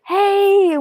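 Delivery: a high, bright cheer; excited cheer. Retold or imitated. Mother celebrating the job offer, high excited cheer. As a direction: whoop it like you just got hired.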